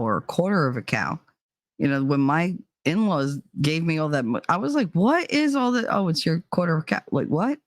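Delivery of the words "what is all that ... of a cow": ordinary talk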